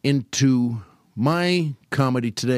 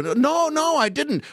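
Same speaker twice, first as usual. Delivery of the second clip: put-on high little-boy denial; Lou Costello impression; 13 semitones higher, slower than usual. Hustler fools his partner as a little boy, high. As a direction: protest innocently, voice pitched way up. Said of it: "Lou is just a little boy".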